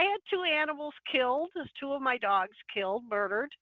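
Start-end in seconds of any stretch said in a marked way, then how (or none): none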